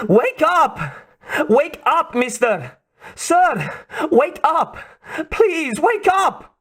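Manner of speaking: a Russian woman desperately urging him to wake; Russian-accented woman calling. A narrator's reading. Russian woman rousing the stunned spy, high urgent Russian-accented calls. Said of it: "Definitely a Russian accent".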